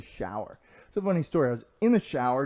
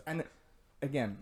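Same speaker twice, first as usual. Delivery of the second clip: normal talking voice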